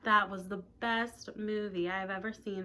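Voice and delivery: ordinary delivery, nothing marked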